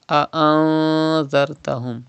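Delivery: "A'anzartahum" with the n held in the nose; Ikhfa with Gunna. Shown as a demonstration. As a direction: hide the n of "A'anzartahum" in a long hum. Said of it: "You have to read like this".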